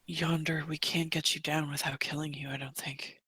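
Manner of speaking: very soft, low and near whispered; hushed voice to a dying captive; hushed in-character. Acted. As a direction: break it to them very quietly. Player gently breaks news to captive.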